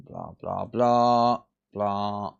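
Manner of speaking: ordinary delivery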